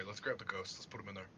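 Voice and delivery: low, quiet scheming; conspiratorial. Acted. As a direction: plot it quietly.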